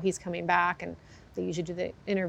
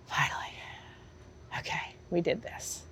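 whispered, breathless relief; relieved whisper; 8 dB quieter than usual. Retold or imitated. Trainer whispering to herself as the race ends, relieved. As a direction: breathe the words out in disbelief. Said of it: "And it was like".

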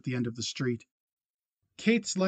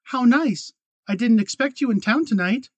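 high, louder false brightness; put-on welcome, much higher and louder; forced pleasant surprise; 9 semitones higher, 7 dB louder than usual. A narrator's reading. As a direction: sound delighted you don't feel. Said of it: "forcing surprise into her voice".

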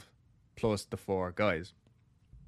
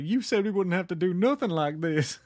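almost in tears; 9 semitones higher, 4 dB louder than usual. Retold or imitated. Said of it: "Poor Lewis Davis was almost in tears".

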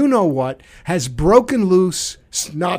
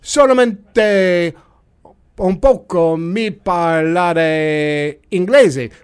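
put-on slow, halting tourist Italian accent; bad pidgin Italian; slower than usual. Tourist excusing himself to the pasta lady, bad Italian accent. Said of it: "A pidgin Italian, very bad".

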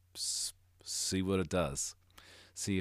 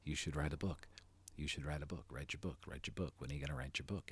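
insistent nudge fading softer and softer; little inner voice; 3 semitones lower, 5 dB quieter than usual. Acted. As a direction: repeat it like a nagging thought, sinking quieter. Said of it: "that little voice inside is saying".

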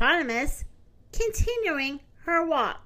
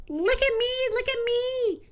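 much higher, sweet singing-bird voice; sweet nightingale voice; 10 semitones higher, 3 dB quieter than usual. Acted. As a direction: chirp it like a proud little bird. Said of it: "in her sweet nightingale voice".

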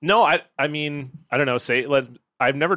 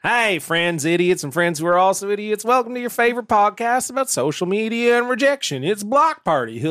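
vintage cartoon voice; 6 semitones higher than usual. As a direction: play a goofy cartoon yokel welcoming listeners. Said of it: "you did the laugh, too".

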